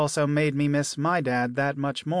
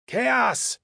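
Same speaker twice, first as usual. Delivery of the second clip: yelled name; 9 semitones higher than usual. Acted. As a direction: call him out sharply. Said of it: "someone yelled from the set".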